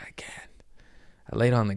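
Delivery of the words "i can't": whispered. Retold or imitated. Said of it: "I was like".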